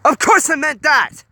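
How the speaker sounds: loud, high, slow snap; snapped. A narrator's reading. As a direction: bite the words off in rage. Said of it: "Honey snapped".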